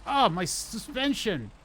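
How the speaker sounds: high, loud, slow dismayed cry. Real, not acted. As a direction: wail over the damaged truck. Truck bashing into guardrail.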